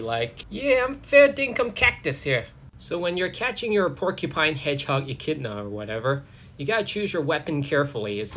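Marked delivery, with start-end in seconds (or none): 0.5-2.5 high-pitched, broad Aussie caricature; Aussie accent. Acted. Stranded echidna complaining in an Aussie accent. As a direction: put on an exaggerated high Australian accent. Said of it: "the echidna is Aussie".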